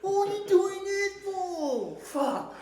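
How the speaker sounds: high, slow mocking slur; mock slurred talk. Mocking the way homeless guys talk, high and slow. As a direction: drag the words out, mimicking them.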